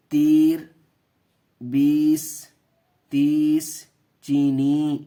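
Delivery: تیر بیس تیس چینی read loud with a long ی; loud word demo. Shown as a demonstration. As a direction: call out each word clearly. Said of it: "دیکھیے".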